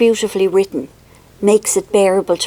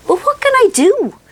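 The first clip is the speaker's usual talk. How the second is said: high squeaky mock helplessness; 12 semitones higher than usual. Boasting to viewers about the baby, high and squeaky. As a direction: squeak it out, playfully smug. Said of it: "I think it's my squeaky voice".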